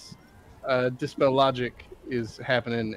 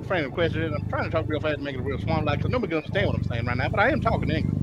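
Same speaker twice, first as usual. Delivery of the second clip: rapid-fire put-on Southern twang; fast Southern twang; faster than usual. Player introducing himself by request, fast unintelligible Southern twang. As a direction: run the words together in a thick, fast Southern twang. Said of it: "I'm talking to Southern Twang 'cause Frank requested it".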